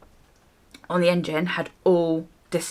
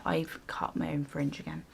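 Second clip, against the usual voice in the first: whispered confession; sheepish whisper; 3 semitones lower, 11 dB quieter than usual. Real, not acted. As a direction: whisper it like a guilty secret. Confessing the fringe cut.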